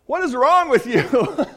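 loud, high imitation of an exuberant friend; booming mock reproach; enthusiastic mock scolding. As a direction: playfully shout in disbelief, imitating him. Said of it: "he's a real enthusiastic guy".

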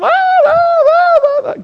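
hammed-up tantrum babble; whiny wail. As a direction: whine like a fussing kid. Us fussing before God.

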